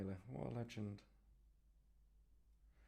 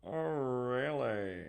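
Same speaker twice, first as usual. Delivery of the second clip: hugely drawn-out, loud "really", falling away; Ace Ventura; 5 dB louder, much slower than usual. Shown as a demonstration. Painter playing with the accent, exaggerated comic drawl. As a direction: stretch every vowel, goofy and loud. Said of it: "it sounded a little bit like Jim".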